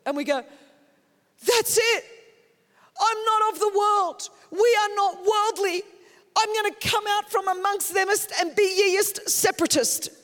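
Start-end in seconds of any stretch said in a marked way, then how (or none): 1.4-10.1 higher, louder pompous mock-pious zealot; slow, pitched-up faux-archaic preacher, laughing at the end; mock King James English. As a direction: ham up a self-righteous separatist in fake King James English. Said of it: "In King Jamest Englishest".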